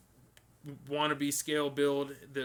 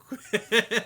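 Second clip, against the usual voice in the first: laugh; 7 semitones higher, 8 dB louder than usual. Real, not acted. Laughs at long tangent.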